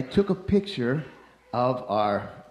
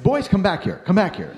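calling out; 6 semitones higher, 4 dB louder than usual. Retold or imitated. Calling the boys back to him.

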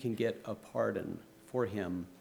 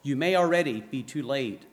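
loud, fast, higher command; barked order; 3 semitones higher, 5 dB louder than usual. Acted. General orders the guard to hurry, barked and fast. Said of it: "he barked".

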